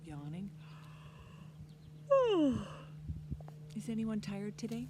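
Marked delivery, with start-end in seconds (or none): 0.5-3.7 big airy yawn, high and breathy; demo yawn. Shown as a demonstration. Teacher showing children a yawn, big airy yawn. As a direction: yawn out loud to show the kids. Teacher demonstrating yawning to kids.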